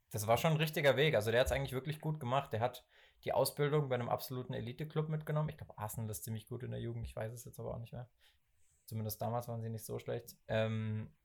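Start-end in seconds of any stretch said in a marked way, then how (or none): none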